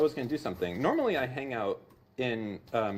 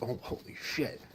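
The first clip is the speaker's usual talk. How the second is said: hushed awe; 6 dB quieter than usual. Retold or imitated. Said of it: "I'd be like".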